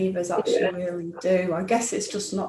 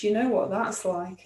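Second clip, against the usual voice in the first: ordinary delivery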